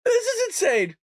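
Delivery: very high, incredulous outburst; real cracked-up amazement; laughing disbelief. Real, not acted. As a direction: squeal it, still recovering from laughter. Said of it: "Oh, God, I'm crying".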